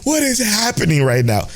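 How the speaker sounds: high, slow, stunned voice; mock astonished crying. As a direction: replay the moment tears hit, dazed. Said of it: "I'm like".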